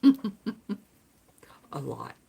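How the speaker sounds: breathy giggle under the breath; laughing whisper. Real, not acted. Giggly aside about Southern girls.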